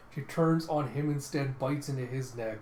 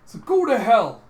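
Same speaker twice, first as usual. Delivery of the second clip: high, very loud curse at enemies; furious shout; 10 semitones higher, 12 dB louder, slower than usual. Acted. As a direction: shout it in full fury. Villain-cursing outburst in retold fight.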